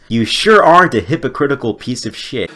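usual speaking voice

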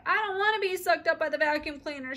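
put-on a frightened little girl crying; sobbing. A narrator's reading. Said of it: "she sobbed".

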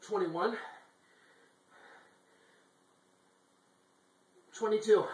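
counting reps mid push-up, strain building; doing push ups. Real, not acted. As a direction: count each rep through the effort, pushing harder at the end.

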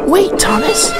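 Percy calling to stop Thomas. A narrator's reading. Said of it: "he cried".

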